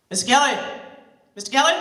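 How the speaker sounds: loud officer calling; loud police calling. Acted. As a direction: call through the door. Police knocking.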